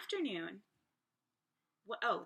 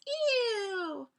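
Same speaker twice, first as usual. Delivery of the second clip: high, loud, drawn-out ew; disgusted ew; 10 semitones higher, 7 dB louder than usual. A narrator's reading. Gosling refusing the bear's food, disgusted squeal. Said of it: "they're not very appreciative".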